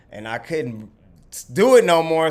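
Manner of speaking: usual speaking voice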